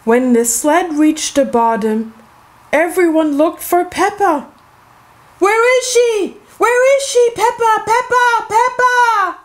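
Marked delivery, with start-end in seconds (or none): 5.4-9.4 calling out searching. Acted. Friends searching the hill, calling for Peppa. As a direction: call around looking for a lost friend.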